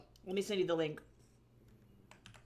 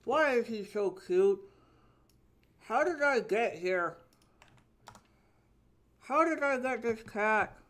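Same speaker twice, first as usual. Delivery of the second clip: higher and louder, squealing with delight; gushing over the cat; 6 semitones higher, 8 dB louder than usual. Real, not acted. Owner gushing over how cute her cat is, higher and louder. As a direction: gush like over a new kitten.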